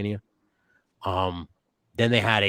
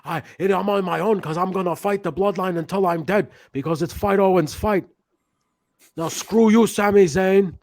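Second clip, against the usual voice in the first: much higher, louder Canadian wrestler voice; Bret Hart impression; 11 semitones higher, 5 dB louder than usual. Host imitates Kevin Owens, sounds like Bret Hart. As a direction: ham up a Canadian wrestler's defiance. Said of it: "Kevin Owens was like".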